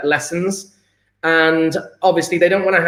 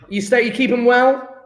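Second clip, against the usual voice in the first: ordinary delivery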